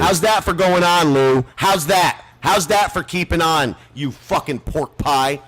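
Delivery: furious host taunting Lou; angry taunt. Real, not acted. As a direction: yell the challenge in his face.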